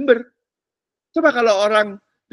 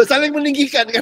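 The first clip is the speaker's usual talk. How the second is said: high, loud, amused laugh through the words; 6 semitones higher, 4 dB louder than usual. Real, not acted. Preacher joking with the congregation, laughing. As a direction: crack up at your own remark.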